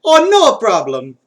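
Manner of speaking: put-on drawn-out Mario accent. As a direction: stretch it out in a cheery Mario accent. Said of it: "replied Mario".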